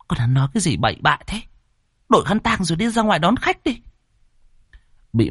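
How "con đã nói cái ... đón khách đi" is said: high, sharp motherly telling-off; angry scolding. A narrator's reading. Mother orders her son out to greet guests, angry scolding. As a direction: scold the child and send him off. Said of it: "nghe nó nói xong thì tức giận".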